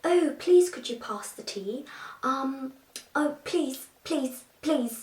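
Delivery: put-on polite tea-table British caricature accent; posh British accent. Mocking Hollywood's idea of Brits, posh British accent. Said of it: "It's always someone like".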